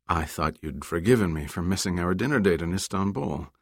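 ordinary delivery

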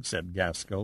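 plain reading voice

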